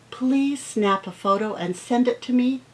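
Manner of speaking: ordinary talk, nothing marked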